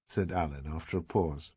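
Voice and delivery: ordinary delivery, nothing marked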